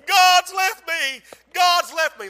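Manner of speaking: high, loud, slow moaning; keen it out in grief; sobbing lament of abandonment; despairing wail. Retold or imitated.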